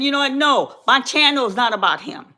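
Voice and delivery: ordinary talk, nothing marked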